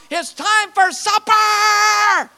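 high, drawn-out calling holler; long, slow cry held on a high note. Retold or imitated. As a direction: sing it out like a mother's supper call. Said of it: "is calling out".

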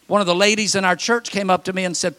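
ordinary talk, nothing marked

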